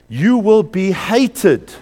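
loud, high and very drawn-out warning; emphatic declamation. Retold or imitated. Preacher declaims a scriptural warning.

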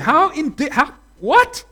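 incredulous relatives. Retold or imitated. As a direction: sputter in disbelief. Relatives protesting in disbelief.

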